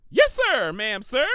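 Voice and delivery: high, loud, snappy Pinky salute; eager salute. Acted. Pinky saluting Twilight, high and eager. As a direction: bark it like a keen cadet.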